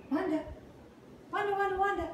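high sing-song call across the room; calling the puppy. Real, not acted. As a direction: call her name up high.

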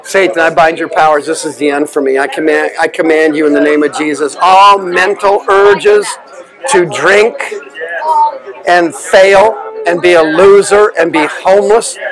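shouted exorcism commands; deliverance shouting. Acted. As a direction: order the demon out at full force. Casting out a demon.

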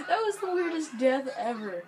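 loud, high words bursting out of laughter; laughing exclamation. Real, not acted. Gamer amazed by a silly death, laughing exclamation. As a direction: laugh hard, then call it out loudly.

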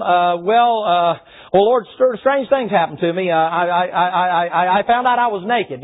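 halting, stuck on "I, I, I"; sheepish stammering. Acted. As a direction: stall and stumble through an awkward confession. Adam stammers excuses to God.

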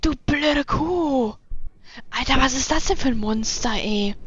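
put-on loud, slow angry old man yell; grumpy old man. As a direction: shout it like a cranky old geezer. Voicing grumpy character yelling at woman.

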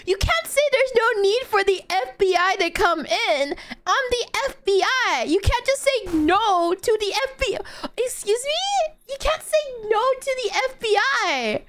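high-pitched, incredulous arguing; mock-outraged complaint; indignant protest. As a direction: argue back in disbelief, voice pushed up high. Arguing with granny who refuses FBI.

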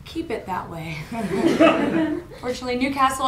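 usual speaking voice

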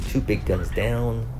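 usual speaking voice

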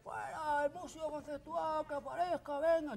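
put-on high rowdy drunk yelling for the musician; heckling drunk. Drunk wedding guest heckling for the musician, high rowdy yell. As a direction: shout it up high like a tipsy pest. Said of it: "Un patoso".

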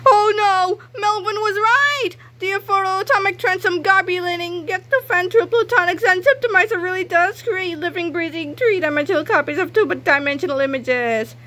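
high, loud frantic wail; panicked crying out. Acted. Harold wailing in terror at the toilets, high frantic cry. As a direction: blurt it out in terror. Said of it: "Harold cried convulsively".

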